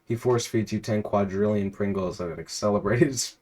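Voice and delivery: ordinary talk, nothing marked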